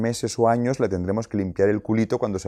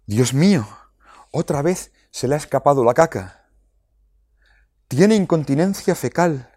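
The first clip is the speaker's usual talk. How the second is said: mock despairing parent; 6 semitones higher, 3 dB louder than usual. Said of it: "sus padres dijesen".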